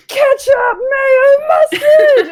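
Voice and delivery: very high, loud screaming incantation; spell-casting shout. Acted. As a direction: scream each word like magic.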